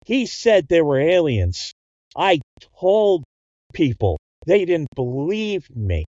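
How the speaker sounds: high, slow and pleading; frantic slow rant. Acted. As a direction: plead that nobody believed him. NPC ranting about aliens.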